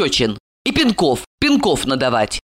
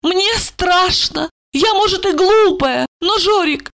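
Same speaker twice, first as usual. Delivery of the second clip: high-pitched plaintive woman; frightened tipsy lament; 13 semitones higher than usual. A narrator's reading. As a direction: wail your fear in a high voice. Drunk woman laments her fear.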